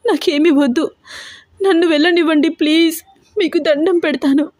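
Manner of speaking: high, crying plea to be let go; girl begging the villain to release her; tearful pleading. A narrator's reading. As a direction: beg through tears. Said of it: "ఏడుస్తూ బ్రతిమలాడుతూ అడుగుతోంది హారిక".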